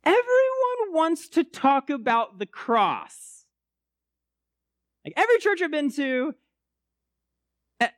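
high, intense ranting impression; mocking the endless cross talk, pitched high. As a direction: rant like a fired-up friend. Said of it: "He says".

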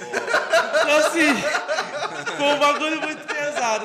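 talking through real laughter; laughing. Real, not acted. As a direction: laugh while you say it. Laughing while telling dam joke.

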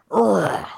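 loud, high ugly grunt; ugly growl. Acted. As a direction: force out the grossest noise you can. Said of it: "Make the ugliest, grossest sound you can".

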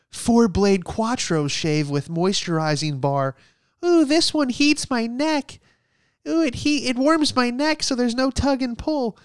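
high, prissy mocking voice, slowed; pampered shaver. Comic mocking modern men cooing over fancy razors, high and prissy. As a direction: camp it up like a spoiled man at his shave.

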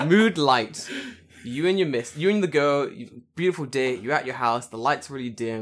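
low, hushed seductive narration; scene setting. Pretend. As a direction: set a romantic mood, softly and deep. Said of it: "I have to set the scene".

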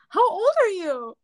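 high, pointed asking voice; mock the curious chat partner; imitated stranger's question. Omegle stranger asking her age, mock high voice. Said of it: "Then they'd be like".

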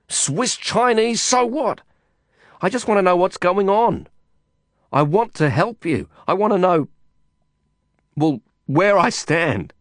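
high-pitched, heated insistence; exasperated. A narrator's reading. Man demanding to know where he stands, exasperated. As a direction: plead for answers with frustration boiling up.

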